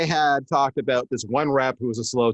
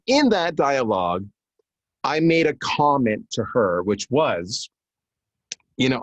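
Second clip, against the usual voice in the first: normal talking voice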